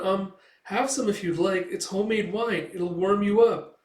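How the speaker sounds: high-pitched, shy offer; young girl. Retold or imitated. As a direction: offer it kindly in a girl's voice. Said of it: "the girl comes back with it".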